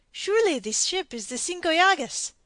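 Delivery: clutching at certainty; bewildered don. A narrator's reading. Don Diego begs to know his ship, bewildered.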